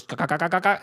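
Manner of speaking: choppy mock helicopter sound made with the mouth; helicopter rotor noise. Telling a movie plot to friends, mimicking helicopter blades. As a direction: go ka ka ka like spinning blades. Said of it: "the helicopter goes".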